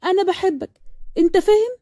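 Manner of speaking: high, possessive and worked up; heated jealous girlfriend in full flow; jealous outburst. Acted.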